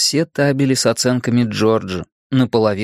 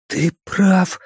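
higher-pitched, wheezed admission; hoarse croak; 8 semitones higher than usual. A narrator's reading. Richie agrees with Bill in the room, hoarse. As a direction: croak it out, higher than usual. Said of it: "просипел Ричи".

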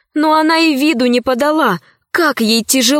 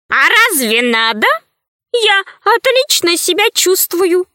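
high, put-on character voice; oblivious sage; 7 semitones higher than usual. A narrator's reading. Sage tells Ирия he feels fine, high oblivious voice. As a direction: answer blithely, climbing into a high voice.

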